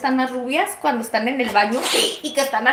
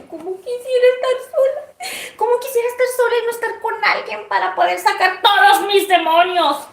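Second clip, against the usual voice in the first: laughing wish; 7 semitones higher than usual. Retold or imitated. Wishing to be alone, laughing.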